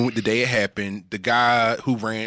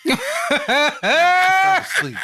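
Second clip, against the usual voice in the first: laughing; 6 dB louder, much slower than usual. Real, not acted. Laughing through the punchline.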